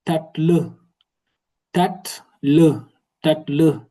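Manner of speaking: repeating tacle slowly and distinctly; Turkish word tatlı. Shown as a demonstration. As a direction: model tacle crisply for learners. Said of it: "qui signifie le dessert".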